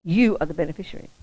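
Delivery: usual speaking voice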